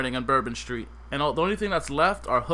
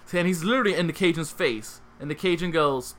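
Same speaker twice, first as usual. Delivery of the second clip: ordinary delivery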